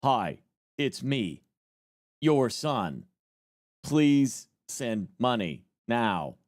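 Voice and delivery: stiff, slow robot caller; robotic AI voice. Retold or imitated. Mocking what viewers imagine an AI scammer sounds like, stiff and slow. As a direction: play a clunky fake AI scam call. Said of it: "AI is gonna talk to you like".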